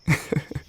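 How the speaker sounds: quiet, breath-light little laugh; soft chuckle. Acted. Witch admitting a fear to darling, soft chuckle. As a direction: laugh softly at your own confession.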